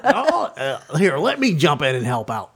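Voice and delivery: lightly put-on comic parrot sidekick; Gottfried voice. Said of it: "that Gottfried voice".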